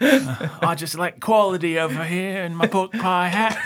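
high, laughing fake Cockney accent; mock a Cockney in a pork pie hat; hammed-up fake Cockney. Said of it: "fake Cockney accent".

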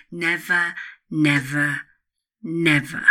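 loud, emphatic never; stern warning. Acted. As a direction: bang the word down like a final order. Repeated warning, hammered home.

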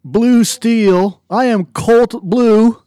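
booming high announcer intro; fighting game announcer. Acted. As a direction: announce your name like a fighter. Announcer-style self-introduction.

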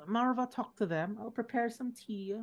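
lightly voiced old woman. Thonu addresses wife.

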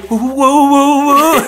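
high, loud "woo-woo" whoops; teasing, hammed-up woos; playful whooing. Real, not acted. Playful reaction to her thick voice.

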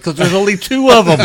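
high, loud, laughing through it; cracking up at the irony; retold retort dissolving into laughter; laughing punchline.

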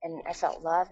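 ordinary delivery

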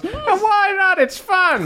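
very high, carefree mad doctor; breezy doctor voice. Acted. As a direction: wave it off, high and gleeful. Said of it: "Lanfizz just waves his hand and goes".

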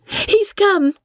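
very high breathless announcement; Polly announcing joyfully to Amelia; excited cry. A narrator's reading. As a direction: burst out with the news. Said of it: "cried Polly".

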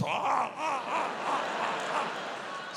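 high, breathy walrus honks; baby walrus noises. Retold or imitated. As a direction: honk like an excited baby walrus. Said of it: "turned into a baby walrus".

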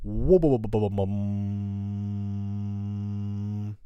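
babbled wobble into a long held hum; imitated impact noise. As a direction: wobble the syllables, then drone on the last. Imitates the moment it hit him.

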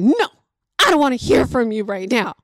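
mock tantrum refusal from a six-year-old; imitated bratty child's outburst; drama queen child. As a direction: snap it like an overdramatic kid. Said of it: "the Drama Queen Award goes to".

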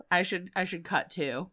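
usual speaking voice

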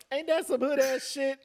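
high-pitched laughing question. Real, not acted. Laughing after coughing fit.

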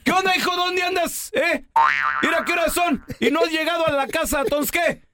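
high, nagging wife voice, half-joking; angry wife voice. Retold or imitated. Wife calls her late husband, high mock scolding. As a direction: demand to know where he is, mock scolding. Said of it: "Y le dice, le dice".

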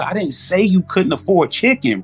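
ordinary talk, nothing marked

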